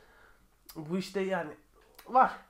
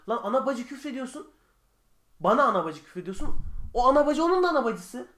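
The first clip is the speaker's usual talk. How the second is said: louder, higher mock telling-off; retold angry scolding; 4 semitones higher, 5 dB louder than usual. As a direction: scold the commenter with rising heat. Retelling scolding a comment swearer. (yorumcuyu azarlarken yükselen öfkeli ses)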